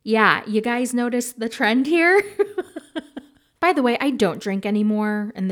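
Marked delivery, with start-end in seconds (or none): 2.3-3.2 high soft laugh; real giggle. Real, not acted. Laughs at the trend.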